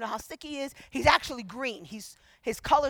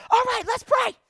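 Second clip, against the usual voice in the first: high, loud eager call; rallying shout; 10 semitones higher, 8 dB louder than usual. Retold or imitated. Girlfriend stopping everything to start prayer, loud and eager. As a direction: belt it out to rally the room. Game-changer friend takes over.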